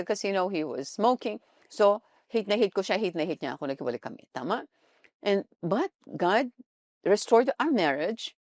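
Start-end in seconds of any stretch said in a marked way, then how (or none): none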